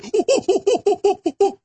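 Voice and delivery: high, put-on gloating laugh; mocking laugh. Imitating critics cackling at him, high mock laugh. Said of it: "I'm sure the clips will be".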